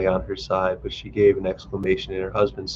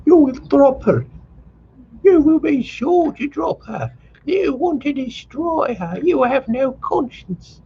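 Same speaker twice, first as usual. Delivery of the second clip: high, louder, slowing accusing shout; shouting mother; 4 dB louder, slower than usual. A narrator's reading. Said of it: "began to shout still louder".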